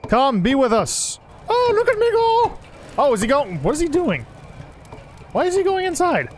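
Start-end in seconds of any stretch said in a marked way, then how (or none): 1.5-2.6 very high gleeful voice; squeaky villager. Pretend. Voicing the running villager, very high gleeful squeak. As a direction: squeal it as the little runner.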